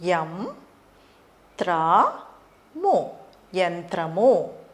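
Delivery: యంత్రము split యం త్ర ము, then joined slowly; syllable by syllable. Shown as a demonstration. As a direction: sound it out beat by beat. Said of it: "దీన్ని ఎలా పలకాలో చెప్తాను". (అక్షరాలుగా విడదీసి నెమ్మదిగా)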